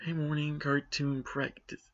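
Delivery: usual speaking voice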